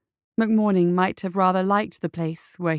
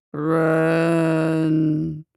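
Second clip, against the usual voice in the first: zombie moan; 2 semitones lower than usual. A narrator's reading. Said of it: "one of them moaned".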